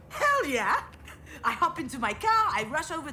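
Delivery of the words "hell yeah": high, loud whoop of joy; excited cheer. Retold or imitated. Daughter retelling her big news with a loud joyful whoop. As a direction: cheer it like you just won.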